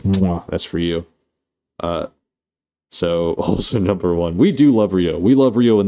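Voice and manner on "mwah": a playful "mwah" kiss; blown kiss. Pretend. Streamer giving a viewer a free kiss, playful smooch. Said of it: "I'm gonna give you a free kiss".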